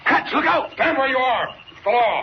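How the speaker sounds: sudden high shout of alarm; shouting a warning. Acted. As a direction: bark it out to stop them in their tracks. Police surprise the intruders.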